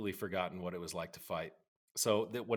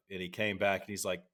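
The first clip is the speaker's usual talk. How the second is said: usual speaking voice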